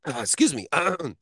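choked on a swallow, throat clearing; choking. Real, not acted. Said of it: "I'm over here choking".

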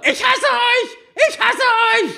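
put-on high, shrill teenage scream of hate; high-pitched teen girl yelling at her parents. A narrator's reading. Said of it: "schreit weiter".

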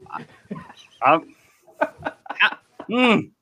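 nervous, halting and stammering; stage fright. Real, not acted. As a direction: trips over words with nerves. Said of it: "I'm nervous 'cause I have family".

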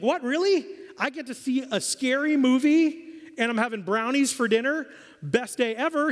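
put-on high, thrilled kid voice; overjoyed, can't believe his luck; imitating a boy who gets brownies and a movie; excited kid. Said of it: "the kid was shocked and excited".

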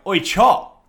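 loud call across the crowd. Retold or imitated. Calling out to a passing stranger, loud hail. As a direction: shout his nickname at him.

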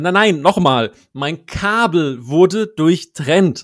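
firm, loud and slow; insistent loud retort. Retold or imitated. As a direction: insist over the agent, loud. Retold insisting at hotline.

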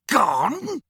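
high, incredulous outraged yelp; enraged disbelief. A narrator's reading. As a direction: spit the word back in rage. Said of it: "his face contorting with rage".

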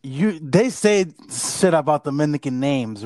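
ordinary talk

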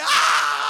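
loud scream of sudden back pain. Retold or imitated. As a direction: cry out as the pain wakes you. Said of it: "Te dije que tenía que gritar". (grito de dolor fuerte)

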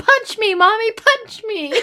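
put-on high, slow-ish playful kid voice, laughing; a son giddily begging his mother to punch him, high and playful; mock child begging. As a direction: play the little kid pleading with Mommy to hit him.

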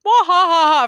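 loud, high mock guffaw; exaggerated spelt-out ha-ha-ha; imitated laughter. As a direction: fake a big belly laugh. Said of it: "for, like, ten hours".

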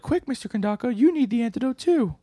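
very high put-on daughter voice, urgent; high-pitched mock child's plea; urgent little girl. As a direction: imitate a child urging a grown-up to hurry. Same daughter urges him to hurry.

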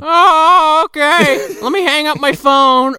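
put-on high, loud drunk dad; half-laughing drunk old man.

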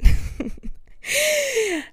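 high squealing laugh; a sudden high giggle; high burst of laughter. Real, not acted. Laughing about hunting a chicken.

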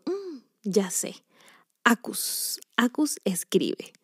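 trying out her pen name, whispered to herself; whispered idea. Retold or imitated. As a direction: try out the new name under your breath.

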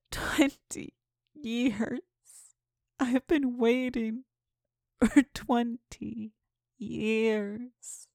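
slow, ghostly drawn-out lament; moaning ghost. Acted. Catherine's ghost lamenting her long wait, slow ghostly moan. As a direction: ache through every word, slowly. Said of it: "moaned the voice".

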